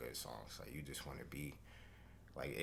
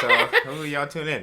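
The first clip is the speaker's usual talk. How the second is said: laughing; 9 semitones higher, 17 dB louder than usual. Real, not acted. Laughing plug for the tape.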